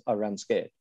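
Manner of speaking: ordinary talk, nothing marked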